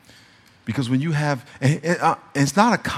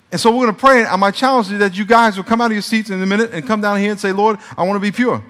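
rising altar call; 5 semitones higher, 6 dB louder than usual. Acted.

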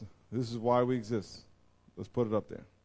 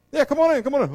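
eager welcome; 11 dB louder, faster than usual. Retold or imitated. Welcoming host.

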